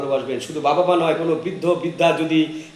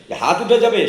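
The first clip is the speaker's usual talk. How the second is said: angry threat; 7 semitones higher, 5 dB louder than usual. Acted. Husband threatens wife.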